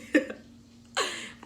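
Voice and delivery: short laugh at own mistake; burst of laughter. Real, not acted. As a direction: laugh it off, caught out.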